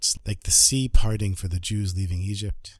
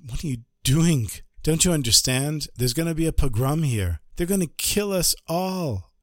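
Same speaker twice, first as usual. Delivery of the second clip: panicked man scolding the rebbe; alarmed protest; 6 semitones higher than usual. Acted. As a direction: beg him to stop, terrified. Householder alarmed at opened shutters.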